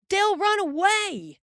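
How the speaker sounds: high singsong warning call. A narrator's reading. Boy calls warning to driver.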